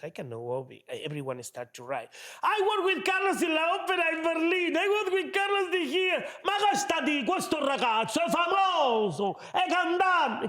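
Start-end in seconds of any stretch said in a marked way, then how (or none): 2.4-10.5 Italian-flavoured fan rave; excited fans. Retold or imitated. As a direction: gush about him like a proud colleague. Fans writing in about Carlos.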